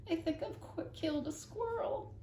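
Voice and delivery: high, worried neighbour voice; dismayed older woman confessing; alarmed confession. Acted. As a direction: stammer out the awful news. Mrs Tickham confesses in alarm.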